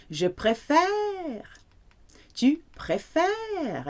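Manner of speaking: préfère slow, high, leaning on the fère; stress on préfère. Shown as a demonstration. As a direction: drag the last syllable of préfère. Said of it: "where the stress goes on the word here".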